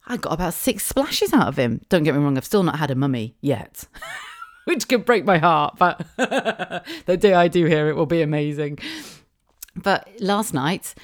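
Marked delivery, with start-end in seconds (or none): 4.2-8.8 laughing. Real, not acted. Laughs while talking about her son.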